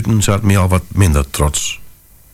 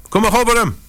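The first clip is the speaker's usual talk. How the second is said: calling lady; faster than usual. Acted. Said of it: "riep ze".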